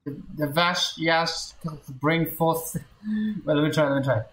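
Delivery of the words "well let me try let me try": ordinary talk, nothing marked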